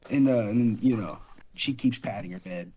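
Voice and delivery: ordinary talk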